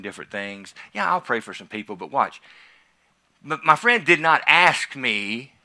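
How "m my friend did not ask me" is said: emphatic preaching. Real, not acted. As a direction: hammer each phrase home to the crowd. Preacher building emphatic point.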